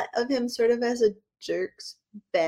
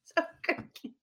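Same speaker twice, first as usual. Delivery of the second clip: laughing; 12 semitones higher, 3 dB louder than usual. Real, not acted. Laughing at joke.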